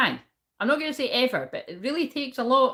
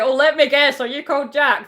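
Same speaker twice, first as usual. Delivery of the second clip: louder, higher sarcastic guess; mock sarcastic reply; 6 semitones higher, 9 dB louder than usual. The speaker mockingly answering the stranger, loud and high. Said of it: "I was like".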